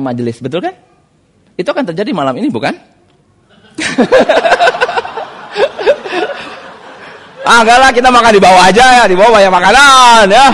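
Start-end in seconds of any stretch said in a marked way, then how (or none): 3.8-7.2 a long, high laugh. Real, not acted. Preacher laughing with the congregation, long high laugh. Joke about dinner tonight. 7.4-10.5 loud and fast, teasing; loud joking aside. Real, not acted. Preacher joking to the congregation about dinner, loud and fast.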